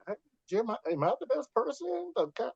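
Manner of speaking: small, high, soft voice; timid questioning, acted out; mock hesitant. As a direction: shrink into a soft, high-pitched doubt. Retells own doubtful reply to co-host.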